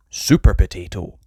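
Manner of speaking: usual speaking voice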